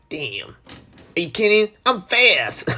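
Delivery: cocky hedgehog brag, trailing to a whisper; Sonic impression. Gamer doing Sonic stuck in a car, mock-cocky whisper at the end. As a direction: toss it off like a smug speedy hero. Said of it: "That's my Sonic impression".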